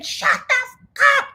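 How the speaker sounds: very high mock screech; hammed-up furious squeal; angry cursing. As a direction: imitate a livid passenger. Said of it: "cursing me out on the plane".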